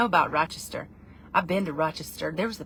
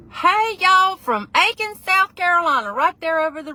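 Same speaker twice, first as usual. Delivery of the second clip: loud, high Southern holler; hammed-up South Carolina y'all, easing down gradually; Southern accent; 11 semitones higher, 11 dB louder than usual. Shown as a demonstration. Performing requested Southern accent greeting.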